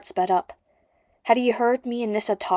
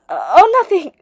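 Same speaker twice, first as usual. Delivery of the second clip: quick, higher, louder cover-up; blurted; 10 semitones higher, 9 dB louder than usual. Acted. Nissa covering a slip of the tongue, blurting loudly. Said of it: "she blurted".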